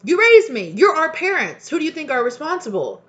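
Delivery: furious shout of blame; raging. Real, not acted. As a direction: blast it at the parents. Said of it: "you guys see me raging".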